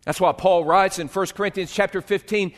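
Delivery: usual speaking voice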